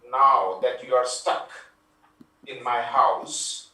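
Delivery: menacing villain. Acted. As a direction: play the puppet killer springing his trap. Jigsaw villain line in remake.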